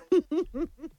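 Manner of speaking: soft, amused chuckle; soft giggle. Real, not acted. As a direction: giggle at your own attempt. Laughs at own attempt.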